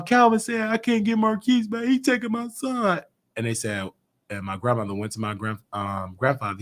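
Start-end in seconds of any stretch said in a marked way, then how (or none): 0.0-3.0 mother crying. Retold or imitated.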